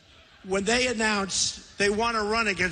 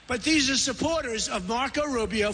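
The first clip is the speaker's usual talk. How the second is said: ordinary talk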